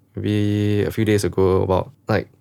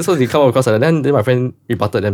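usual speaking voice

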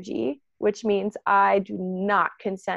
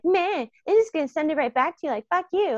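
higher, snippy mock rant at someone; mock indignant outburst; 7 semitones higher than usual. Ego snapping back at a projector, mock indignant. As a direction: play the petty ego firing it back.

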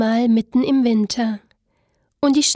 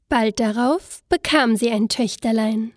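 ordinary delivery

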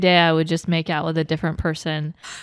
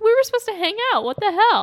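mock indignant; 13 semitones higher than usual.

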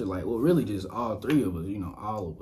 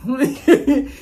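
laughing yes ma'am; 8 dB louder than usual. Retold or imitated. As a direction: give in with a laugh. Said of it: "I'm like".

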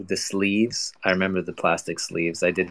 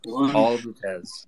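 slow, drawn-out villain gloat; mustache twirling villain; slower than usual. Pretend. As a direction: savor it like a cartoon villain. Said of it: "as he twirls his mustache".

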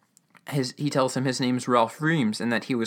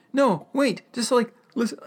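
high-pitched plea to wait; imitated old man pleading; urgent old man; 11 semitones higher than usual. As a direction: stop them with a pleading high voice. Mister Reams urging them to stay.

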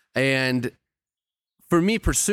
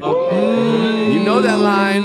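excited drawn-out emphasis; 9 semitones higher, 6 dB louder, much slower than usual. Real, not acted. Excited about quoted lyric.

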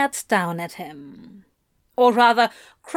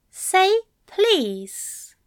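higher, teasing command; commanding Aziraphale; 10 semitones higher than usual. A narrator's reading. Aziraphale making Crowley beg, high and teasing. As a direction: demand it sweetly.

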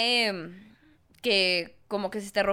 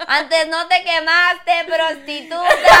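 mock shouted insult; 5 semitones higher, 11 dB louder, much slower than usual. Continuing the mock church insult.

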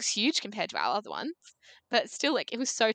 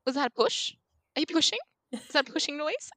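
higher, rising questions of a startled partner; alarmed husband; 8 semitones higher, faster than usual. Retold or imitated. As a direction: voice the husband suddenly alert and worried. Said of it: "and he goes".

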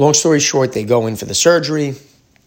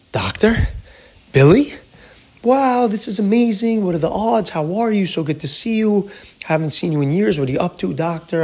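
surprised delighted reunion; 10 semitones higher than usual. Retold or imitated. Surprised reunion with old acquaintance.

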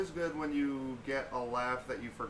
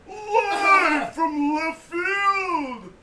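botched announcer wail, very high, loud, drawn out; Don Pardo; 12 dB louder, much slower than usual. Acted. Host failing at Don Pardo, high wailing impression. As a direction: overblown high howl of a show intro. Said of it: "now I feel like I can't".

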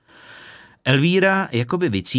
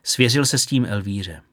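ordinary delivery

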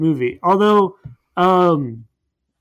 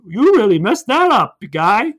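higher, louder cartoon taunt; mock taunt; 5 semitones higher, 5 dB louder than usual. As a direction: jeer at the loser like a sports announcer. Commentator voiced mocking villain.

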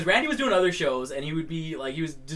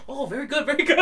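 impressed Japanese fan; 10 semitones higher than usual. Retold or imitated. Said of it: "and I'm like".

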